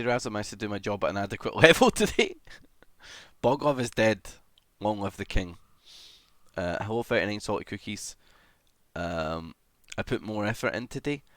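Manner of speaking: ordinary delivery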